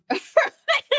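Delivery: a sudden high laugh bursting out; giggling high, amused; high burst of laughter. Real, not acted. Laughs at the old costumes.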